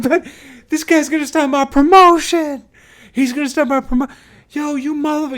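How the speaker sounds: high-pitched, shouted tirade in imitation; enraged swearing. Promoter complains to Patty about the refusal, furious yelling. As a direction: yell as if outraged and calling for backup. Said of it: "he starts swearing at me".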